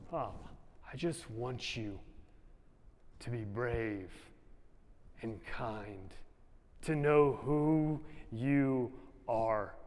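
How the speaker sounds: put-on soft, slow, tender wish to his kids; tender yearning. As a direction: whisper a father's longing gently. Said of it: "That's what I want".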